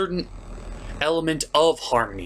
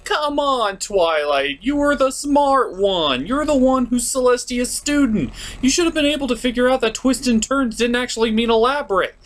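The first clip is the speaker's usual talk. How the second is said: higher, pressing scold; exasperated scolding; 9 semitones higher than usual. Real, not acted. Reviewer scolding Twilight for missing the riddle, higher and exasperated. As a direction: scold her like she should know better.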